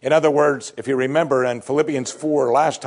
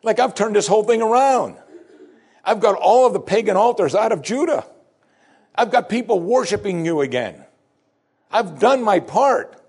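pleading Hezekiah; 8 semitones higher than usual. Acted. King protesting to God that he served well, higher and pleading. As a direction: plead your record with God, voice climbing. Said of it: "He says".

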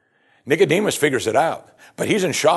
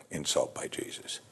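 whispered, almost under the breath; hushed aside; 8 semitones lower, 13 dB quieter than usual. Real, not acted. Preacher to congregation, a hushed whispered aside. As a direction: drop to a near whisper for emphasis.

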